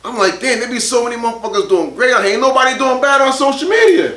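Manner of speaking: ordinary delivery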